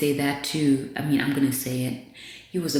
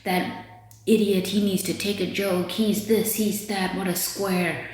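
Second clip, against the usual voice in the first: higher-pitched mocking chatter; mocking onlookers; 6 semitones higher than usual. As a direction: imitate people making fun of someone. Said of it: "people were laughing and".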